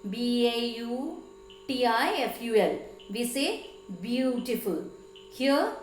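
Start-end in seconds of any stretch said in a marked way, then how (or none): 0.1-2.8 "B A U T I F U L" named letter by letter; spelled out. Shown as a demonstration. Teacher showing how we don't say the word, spelled out. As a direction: say each letter name of "B A U T I F U L" separately. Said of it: "we don't say".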